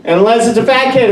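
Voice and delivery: normal talking voice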